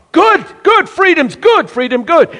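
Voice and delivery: high, bright mock praise of freedom; mocking cheer. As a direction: sing out a hammed-up cheer.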